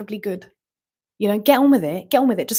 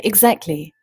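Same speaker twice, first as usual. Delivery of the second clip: normal talking voice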